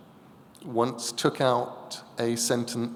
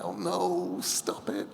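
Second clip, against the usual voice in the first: mock cringe; 6 semitones higher, 4 dB quieter, slower than usual. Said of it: "it's like".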